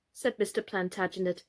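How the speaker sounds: usual speaking voice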